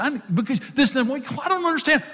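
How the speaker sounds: high, halting mock protest; imitating flustered wife. Wife's flustered reply, retold.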